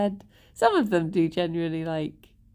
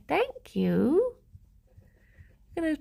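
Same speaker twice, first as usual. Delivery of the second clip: higher cutesy "thank you." with a rising end; cutesy pet voice; 8 semitones higher than usual. Real, not acted. Thanking rabbit while grooming.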